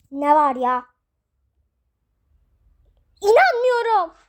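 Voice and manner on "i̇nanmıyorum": louder, higher outburst; wife shocked by the call; outraged disbelief. Acted. Wife hears of another wife. (öfkeyle, yüksek sesle)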